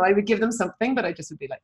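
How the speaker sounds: ordinary delivery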